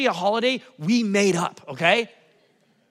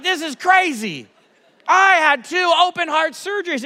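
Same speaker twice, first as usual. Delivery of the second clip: put-on higher, louder eager kid voice; excited daughter; 8 semitones higher, 6 dB louder than usual. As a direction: blurt it out like a thrilled girl. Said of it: "She's like".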